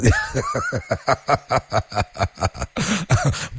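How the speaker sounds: high-pitched cheerful laugh; bright laughing burst. Shown as a demonstration. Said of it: "put a laugh in it".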